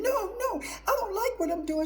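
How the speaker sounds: high, pleading denial, imitating her past self in tears; retold crying denial. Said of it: "I was screaming and hollering and crying".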